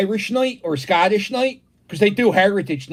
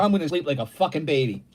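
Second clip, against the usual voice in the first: normal talking voice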